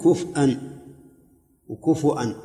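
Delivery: كفءا with a still ف and a glottal stop, then وكفؤا with u and a hamza; slow, separate the readings كفءا and وكفؤا; other readings. Shown as a demonstration. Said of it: "ثلاث قراءات".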